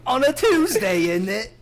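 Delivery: high, laughing mock British; cheeky fake British quip; British accent. British banter continues.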